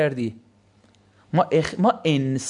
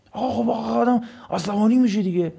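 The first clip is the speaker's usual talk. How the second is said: student's protest; 3 semitones higher than usual. Retold or imitated. Student objects, voiced by lecturer.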